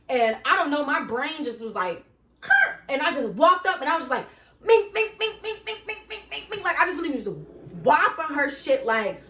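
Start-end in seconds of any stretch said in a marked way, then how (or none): 2.4-2.7 sudden very high loud "huh"; sharp gasp. Retold or imitated. Brain snapping before attacking. 4.6-6.6 punch noises. Retold or imitated. Imitating rapid punches.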